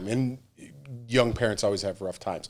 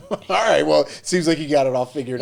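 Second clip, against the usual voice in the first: laughing; 4 semitones higher than usual. Retold or imitated. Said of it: "I was like".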